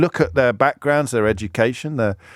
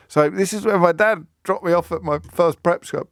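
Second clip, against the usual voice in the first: ordinary talk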